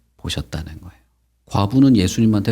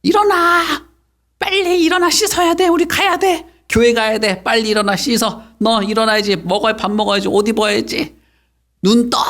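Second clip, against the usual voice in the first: very high-pitched nagging mum; mother waking child; 12 semitones higher, 3 dB louder than usual. Acted. Mother rousing child for church, high urgent nagging. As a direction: fire off wake-up orders in a high shout. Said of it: "엄마가 깨울 때 어떻게 깨워?".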